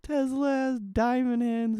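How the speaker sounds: high, slow smug crypto bro; mock the tweet in a high drawn-out voice. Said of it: "he fucking tweets".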